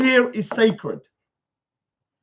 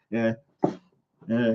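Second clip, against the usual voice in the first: usual speaking voice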